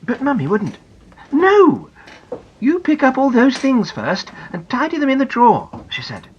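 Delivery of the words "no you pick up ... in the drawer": higher, louder, firm no; high firm mother's refusal. Acted. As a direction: lay down the rule to a child. Mummy refuses firmly.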